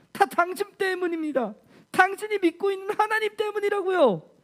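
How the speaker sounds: grieving mother lashing out at Elijah; crying and blaming. Acted. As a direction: sob out the blame, pitched high. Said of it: "이렇게 울면서 원망하고 불평하고".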